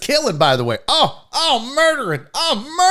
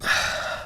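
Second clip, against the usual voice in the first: weary sigh; 8 dB quieter than usual. Retold or imitated. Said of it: "this lady looks at me and goes".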